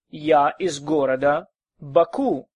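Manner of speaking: normal talking voice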